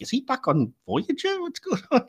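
high-pitched mock confusion with a laugh; retold bafflement. Podcaster recalling first watching that scene, high-pitched amused bafflement. Retells first puzzled viewing reaction.

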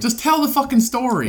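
exasperated. Real, not acted. Friend impatient, interrupts storyteller.

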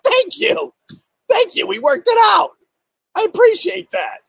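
high, sugary thank-you to the troll; mock sweet thanks. To the Instagram troll, mock-grateful in a high voice. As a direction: sing out thanks in a high, fake-polite voice. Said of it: "And I'm like".

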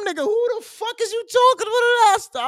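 high-pitched indignant who-are-you-talking-about; offended mock outburst. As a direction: fire it back like you're insulted. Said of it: "I was getting offended".

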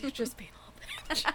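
softly imitated whisper, on the edge of laughter; retold whisper. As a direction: murmur it like a teasing aside in someone's ear. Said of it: "hear him whisper it in my ear".

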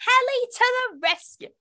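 high, loud helicopter ride call-outs; voz de helicóptero infantil. Shown as a demonstration. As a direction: call out ride catchphrases, stretching the last words high. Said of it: "I would say things like".

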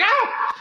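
high startled cry; mock yelp. Waking up to a dildo hit, high yelp. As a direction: yelp like you just got smacked.

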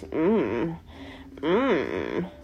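lightly imitated moaning hum; imitated low moan. Imitating the guy humming during the kiss. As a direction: hum it like him mid-kiss. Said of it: "he just goes".

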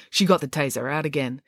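usual speaking voice

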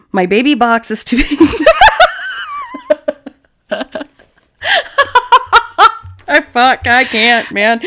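real helpless laughing fit; breaking into high, breathless laughter; losing it laughing. Real, not acted. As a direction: try to read, then crack up completely. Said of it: "I'm trying not to laugh".

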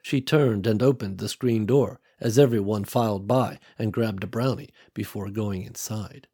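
plain reading voice

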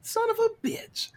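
squeaky high mock curse; hammed-up squeal of betrayal; mock disbelief. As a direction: yelp it in cartoon dismay. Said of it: "They're like".

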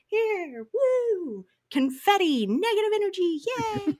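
high squealy party cheer; gleeful mock celebration. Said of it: "throwing candy out of a".

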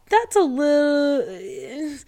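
hesitate, dragging the words; drawn-out hesitation. Real, not acted. Podcaster hesitating to criticise Caroline, drawn out. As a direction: stretch it out slowly, unsure.

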